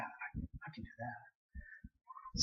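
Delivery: slow, unvoiced whispered self-doubt. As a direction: whisper it slowly, barely convinced. Doubting himself, trailing off.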